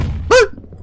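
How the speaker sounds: sharp, loud high gasp; quick shocked intake of breath; startled gasp. Real, not acted. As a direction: gasp in sudden fright. Startled playing horror game.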